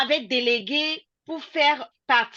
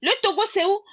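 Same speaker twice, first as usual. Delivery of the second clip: higher, questioning pitch; retold puzzled question; 7 semitones higher, much faster than usual. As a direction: quote someone asking, puzzled. Retold puzzled question about Togo.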